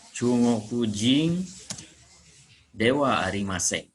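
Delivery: stretched out slow, each word given weight; slow model reading. Shown as a demonstration. Teacher slowing down the phrase for students, slow and deliberate. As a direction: spell the phrase out slowly for learners. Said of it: "wa-nya kata bantu".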